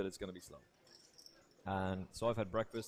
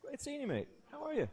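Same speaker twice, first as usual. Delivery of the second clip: much higher, mock-cheerful hello; cheery greeting. Narrator greeting Paul in reception, hammed-up and much higher. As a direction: greet him like an old mate, pitched way up. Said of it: "And I go".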